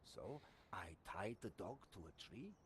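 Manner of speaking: put-on storytelling French sergeant accent; a French soldier confessing; lightly held French accent. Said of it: "you just get a French accent".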